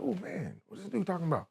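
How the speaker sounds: hushed aside to himself; muttered dismay. Retold or imitated. Student seeing a protester's flag display, muttered dismay.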